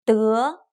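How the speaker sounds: 的 in the rising second tone. Shown as a demonstration. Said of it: "some tones last longer than the others".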